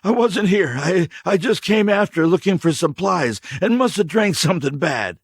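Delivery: high, loud, stumbling excuses; stammering drifter. Acted. Stranger lying to K J, stammering. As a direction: stammer out a lie.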